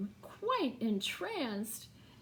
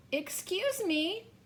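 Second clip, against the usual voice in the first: polite cricket; 7 semitones higher than usual. A narrator's reading. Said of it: "coughed a cricket".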